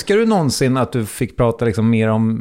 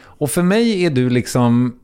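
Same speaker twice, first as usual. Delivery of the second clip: ordinary talk, nothing marked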